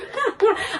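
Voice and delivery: a short high giggle. Real, not acted. Laughing about finding the rare pair, a high giggle. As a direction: let out a pleased little giggle.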